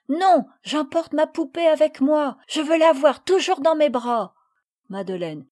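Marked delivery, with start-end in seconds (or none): 0.1-4.4 put-on higher, louder child voice, insisting; stubborn little girl. A narrator's reading. Marguerite refuses to leave her doll, stubborn child voice.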